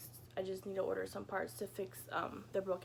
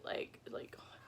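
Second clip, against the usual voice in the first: whisper; 9 dB quieter, much slower than usual. Real, not acted. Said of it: "unvoiced whisper".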